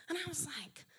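breathy whispered aside. Real, not acted. Telling the church her reaction, whispered. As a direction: hush it to a whisper.

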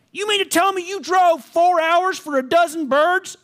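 high, disbelieving farm lady voice; mock the boys for driving so far; Betty scoffing. Betty scolding the city boys.

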